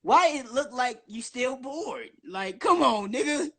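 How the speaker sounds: normal talking voice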